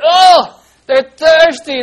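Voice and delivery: loud, high wail; mock distress. Teacher dramatizes thirsty Israelites for children, loud high mock distress. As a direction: wail it out with hammed-up suffering.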